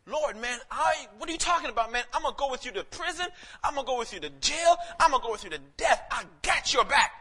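high, fast protest building to a shout; insistent bravado. Retold or imitated. Preacher acts out disciple vowing to follow to death, insistent bravado. Acted disciple's vow of loyalty.

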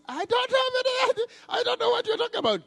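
high, loud hammed-up Mary; Mary voiced. Preacher voicing Mary to the servants, high and loud. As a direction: play Mary high and pleading.

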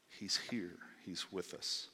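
hushed whisper of presence. Real, not acted. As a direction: breathe it like a secret. Preacher repeats line hushed.

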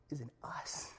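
hushed whisper of the reveal. Real, not acted. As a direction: drop to a whisper for the secret. Sermon reveal of a truth.

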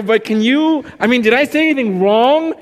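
high, loud retold plea; loud, high-voiced anxious question; worked-up questioning. Retelling anxious exchange after sermon.

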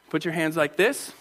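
ordinary talk, nothing marked

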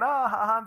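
high mock ha-ha; put-on giggling laugh; imitated laughter. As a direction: copy a child laughing along. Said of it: "she's laughing".

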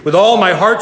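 loud, high outburst; screamed hatred. A narrator's reading. Lily screaming at the killer who took her sisters, loud. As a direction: cry it out with full force. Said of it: "she screamed".

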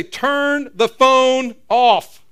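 slow, loud parental order; parental command. Retold or imitated. Parent ordering daughter to turn off the phone, loud and slow. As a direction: spell it out loud and slow to a teen. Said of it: "we finally have to say".

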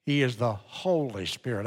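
soft voice, with weight on Holy; stress on Holy. Real, not acted. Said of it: "I emphasize the word holy".

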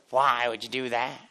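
slow, suspicious imitation of a lady; imitated standoffish questioning; unfriendly stranger. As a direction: challenge it slowly, as a grumpy stranger. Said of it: "even the way that she spoke changed".

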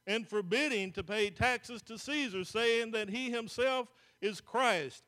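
loud, measured Bible reading from the pulpit; scripture reading. Acted. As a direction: proclaim the verses to the congregation. Reads Luke 23 aloud.

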